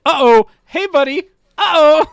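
high, loud, worried mock voice; mock alarmed uh-oh. To the class, a high loud mock warning. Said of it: "And I'm like".

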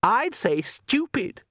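slow, high disdain; sneering daughter. A narrator's reading. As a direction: draw it out high with contempt.

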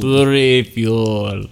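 slow drawn-out Russian vampire; Russian accent. Pretend. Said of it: "my Russian accent".

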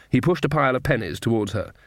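ordinary delivery, nothing marked

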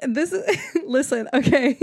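laughing. Real, not acted. Speaker asking listeners to listen, still laughing. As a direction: try to regain composure while laughing.

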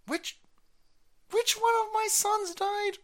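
very high, quavering doddery question; confused old man. Acted. Confused old president asking about his sons, very high doddering voice. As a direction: ask it lost and doddering, way up high.

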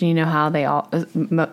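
usual speaking voice

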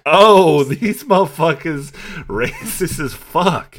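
loud, high-pitched indignant outburst; playing outraged, voice way up; mock outrage. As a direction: blurt it out in hammed-up disbelief, dragging it out. Acted outraged realization about racist couple.

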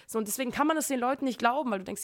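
ordinary talk, nothing marked

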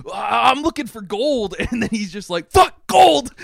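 mock yell about gold; screaming prospector. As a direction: holler it like a crazed gold miner. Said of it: "He just screams about gold".

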